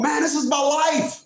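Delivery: high-pitched mock protest; imitated indignant client. Client protesting to his laughing adviser, high indignant imitation. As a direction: plead it high as the offended client. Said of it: "is what he said".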